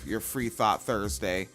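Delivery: usual speaking voice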